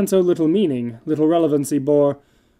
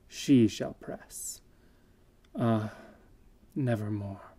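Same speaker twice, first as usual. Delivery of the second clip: slow, sinking whisper of loss; grieving; 6 semitones lower, 10 dB quieter, slower than usual. Acted. Narrator mourning Lenore, soft and slow. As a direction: let it die away in grief. Grief over lost Lenore.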